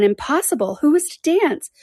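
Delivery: incredulous question, rising high at the end; mildly exclaimed disbelief; crying out. A narrator's reading. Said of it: "cried Mary Ann".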